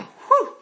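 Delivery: big high loud whew of relief; relieved whew. Retold or imitated. Man retelling finding the cave exit, loud relieved whew. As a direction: blow out a loud sigh of relief.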